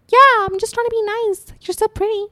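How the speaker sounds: high-pitched fake niceness; mocking, sugary high voice; sarcastic sweetness. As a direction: imitate a phoney mean girl being nice. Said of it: "like, being sarcastic".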